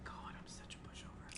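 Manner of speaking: whispered under the breath; hushed aside to oneself; self-reproach. Real, not acted. Player whispering to themself after giving in, self-reproachful.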